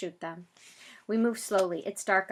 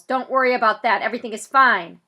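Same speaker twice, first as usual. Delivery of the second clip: louder reassuring shout; yell; 3 semitones higher, 12 dB louder than usual. Acted. Police officer yelling reassurance to Zach. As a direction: call it back over the loudspeaker. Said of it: "the microphone guy yells".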